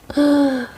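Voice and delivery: sharp whispered gasp; distressed gasp. Retold or imitated. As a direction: catch your breath in distress. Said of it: "힘들어해요". (괴로운 듯 숨을 헉 들이쉬기)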